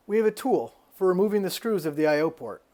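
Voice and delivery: ordinary talk, nothing marked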